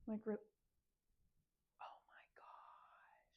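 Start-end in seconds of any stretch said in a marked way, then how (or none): 1.8-3.2 slow, stunned whisper; dismayed whisper. Real, not acted. Sewer to herself seeing the damage, dismayed whisper. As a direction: whisper it drawn out in disbelief.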